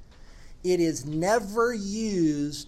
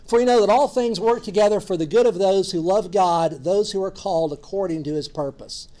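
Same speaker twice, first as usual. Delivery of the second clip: louder scripture reading; 6 dB louder than usual. Shown as a demonstration. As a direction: read the verse out loud and firm. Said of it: "Romans eight twenty-eight".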